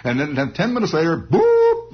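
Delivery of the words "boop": high, loud vocal horn toot; imitated horn. Storyteller imitating the horn that restarts battle, high toot. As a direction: toot like a bugle. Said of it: "the horn goes".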